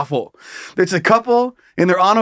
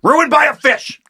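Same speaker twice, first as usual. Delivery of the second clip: a higher, put-on grumbling fisherman; mock fisherman lament; 6 semitones higher than usual. The fisherman sulking around his house, mock bitter. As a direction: sulk about it like he's cursed. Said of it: "He's like".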